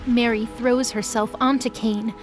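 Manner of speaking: normal talking voice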